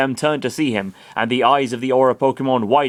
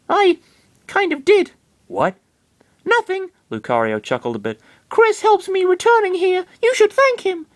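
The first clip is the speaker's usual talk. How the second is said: earnest and squeaky, loyal to his trainer; high Lucario voice. Acted.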